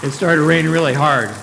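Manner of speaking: loud, rising storytelling; dramatic storm telling. A narrator's reading. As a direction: build the storm's drama loudly.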